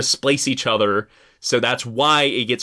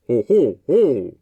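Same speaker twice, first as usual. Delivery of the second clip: owl hoot; 4 dB louder, slower than usual. Shown as a demonstration. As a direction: hoot it like a great horned owl. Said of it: "trying to remember if this is barred owl or great horned owl".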